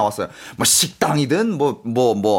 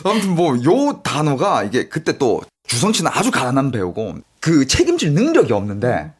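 usual speaking voice

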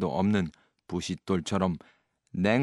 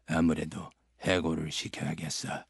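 low, soft menacing mutter; threat; 6 semitones lower, 6 dB quieter than usual. A narrator's reading. 스크루지가 서기를 위협하며, 낮은 협박. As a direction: threaten him under your breath. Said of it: "라고 엄포를 놓았다".

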